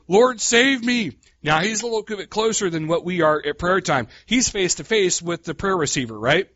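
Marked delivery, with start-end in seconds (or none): 0.1-1.2 high, slow, pleading cry; desperate plea. Retold or imitated. Preacher voicing Peter's slow, desperate plea to God. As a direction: beg for rescue as you sink.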